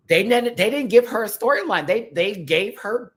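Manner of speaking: high, loud indignant rant; indignant outburst. Real, not acted. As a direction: protest it like it's an injustice. Ranting about the edit.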